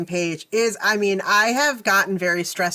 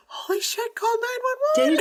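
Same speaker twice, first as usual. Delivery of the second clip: high-pitched panicked relative, played up; hushed start leaping into a high urgent cry; mock alarm; 13 semitones higher than usual. Voicing alarmed family members at funeral.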